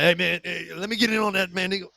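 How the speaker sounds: excited priest quote. Retold or imitated. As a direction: ask fast and high, very eager. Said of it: "he's like".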